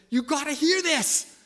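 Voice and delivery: high, loud and slow; excited. Retold or imitated. Husband urging his wife, loud and excited. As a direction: burst with the news.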